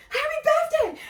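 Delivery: high, excited shouted greeting; imitated crowd cheer. Passers-by answering birthday girl, high imitated cheer.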